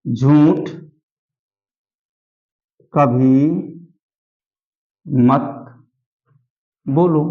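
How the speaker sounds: single words with long silences between; word-by-word dictation. Shown as a demonstration. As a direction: dictate one word, wait, then the next. (एक-एक शब्द रुककर लिखवाते हुए)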